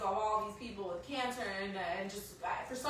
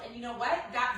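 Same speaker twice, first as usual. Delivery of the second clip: ordinary delivery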